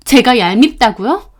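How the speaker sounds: usual speaking voice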